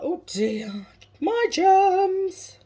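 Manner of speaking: high, loud mock wail on the germs; hammed-up film quote, leaping high and loud; Scary Movie impression. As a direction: squeal it like a comic film character. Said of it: "Scary Movie".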